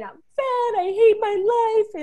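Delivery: retold crying. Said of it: "I'm crying".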